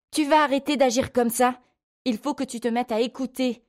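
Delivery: high-pitched scolding without much volume; angry yell. A narrator's reading. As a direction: scold a disobedient child in anger. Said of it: "lui hurla-t-elle".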